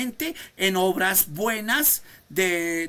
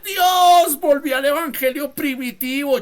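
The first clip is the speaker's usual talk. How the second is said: high, loud cry of "Dios"; preacher shouting his prayer aloud; shout to God; 6 semitones higher, 9 dB louder than usual. Retold or imitated. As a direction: cry it out at full voice.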